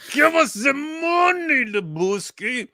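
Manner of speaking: high, slow shouted nihilist demand. Retold or imitated. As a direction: bark it like a movie thug. Lebowski nihilist quote.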